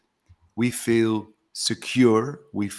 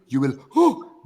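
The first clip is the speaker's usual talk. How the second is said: sharp gasp, then a louder oh; startled gasp; 4 semitones higher, 5 dB louder than usual. Shown as a demonstration. Coach acting out being scared for Bhakti, gasping. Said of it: "If I scare you".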